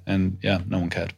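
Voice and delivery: ordinary talk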